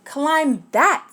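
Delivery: lightly voiced bossy bug; brisk order from the bug; bossy command. A narrator's reading. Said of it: "said the bug".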